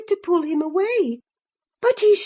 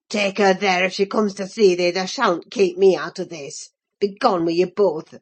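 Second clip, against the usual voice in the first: much lower, rough Yorkshire lad in a rage; furious young Earnshaw; 10 semitones lower than usual. A narrator's reading. Earnshaw throws Linton and his cousin out, low and furious. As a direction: bark him out of the room.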